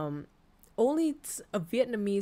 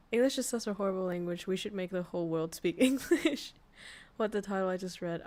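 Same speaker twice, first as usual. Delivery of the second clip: normal talking voice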